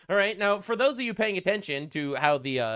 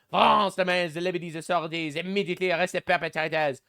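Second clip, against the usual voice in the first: mocking French accent read; Macron's French accent. Reading Macron's tribute post aloud, in a mock French accent.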